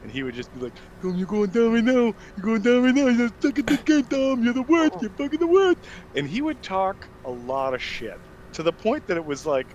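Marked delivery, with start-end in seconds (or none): 1.0-5.8 high, loud imitation of deaf speech; mimicked deaf accent, pitched way up; deaf trash-talker. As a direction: taunt like a deaf gamer talking trash. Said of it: "talk shit as a deaf guy does".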